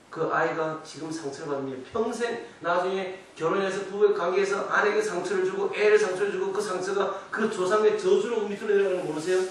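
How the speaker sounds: ordinary talk, nothing marked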